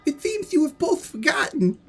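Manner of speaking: very high-pitched put-on god voice; Zeus. The god interrupts two feuding brothers, high put-on voice. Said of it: "That's what he sounds".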